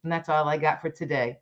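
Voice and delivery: usual speaking voice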